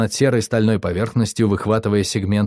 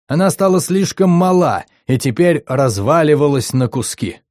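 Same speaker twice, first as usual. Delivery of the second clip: медленно, скорбно и встревоженно, как диктор; голос из динамиков, полный тревоги и скорби; тревожный голос диктора; 3 semitones higher than usual. Acted. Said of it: "Трескучий голос из динамиков был наполнен тревогой и скорбью". (тревожный, скорбный голос диктора)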